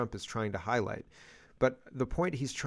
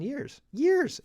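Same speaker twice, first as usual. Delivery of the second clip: leaps way up, louder; excited squeal; 4 dB louder than usual. Real, not acted. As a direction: squeal the word in delight. Exclaiming about years without snow.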